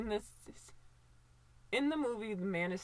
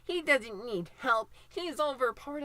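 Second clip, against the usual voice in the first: mocking dismissive people; 4 semitones higher than usual. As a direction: mimic smug critics who brush off his need for help. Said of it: "people are just like".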